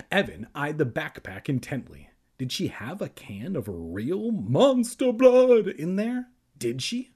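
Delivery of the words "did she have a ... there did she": worried. Acted. Anxious inner question.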